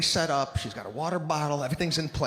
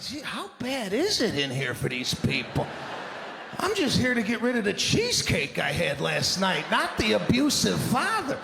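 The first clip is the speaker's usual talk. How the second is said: mock incredulous; 6 semitones higher than usual. Incredulous reaction to teacher.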